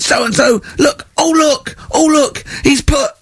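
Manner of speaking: very high, sobbing mock outrage; hysterical teacher. Teacher showing the class a rude drawing, high sobbing outrage. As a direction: wail like a teacher on the verge of tears. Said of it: "'cause he went".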